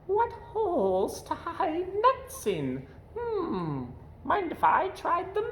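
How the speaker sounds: high, slow little squirrel voice; lightly slowed high squirrel. Acted. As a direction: squeak it out like a curious squirrel.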